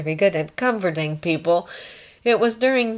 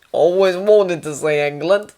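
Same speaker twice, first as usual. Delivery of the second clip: put-on Australian accent; 6 dB louder than usual. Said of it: "Australian, that was what the accent".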